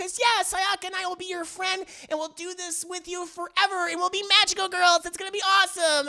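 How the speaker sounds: put-on high-pitched eager girl voice; excited gushing. Acting Monica promising to be Mommy's friend, gushing. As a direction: tumble through it, high and thrilled.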